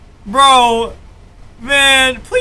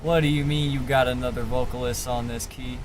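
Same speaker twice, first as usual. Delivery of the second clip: hushed disbelief; 10 semitones lower, 10 dB quieter than usual. Real, not acted. Quiet disbelief at a new vocalist.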